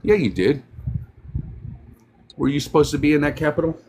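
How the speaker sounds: ordinary delivery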